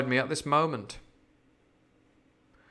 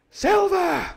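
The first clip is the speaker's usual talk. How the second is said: very high, loud cheer; crew crying out; 6 dB louder than usual. A narrator's reading. Pirates acclaim Silver as leader, crying out. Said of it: "they cried".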